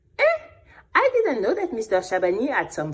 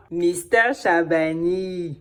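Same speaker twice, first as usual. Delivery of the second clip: usual speaking voice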